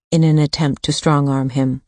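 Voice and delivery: ordinary delivery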